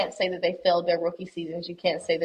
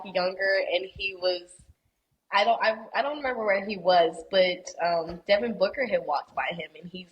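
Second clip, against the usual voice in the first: normal talking voice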